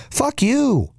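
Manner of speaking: high-pitched, heated telling-off; an indignant dad scolding another parent; scolding rebuke. Acted. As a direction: snap back at a whiner in disbelief. Rebuking the imagined complainer.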